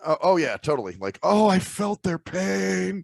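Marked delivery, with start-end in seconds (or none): 1.2-3.0 slow, drawn-out mock sympathy. Mocking people who claim to feel others' pain, drawn-out. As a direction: sneer at fake feeling, slowly.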